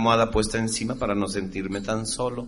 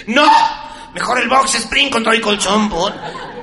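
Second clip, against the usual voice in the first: mock woman demanding; 10 semitones higher, 7 dB louder than usual. Woman voiced through the wall.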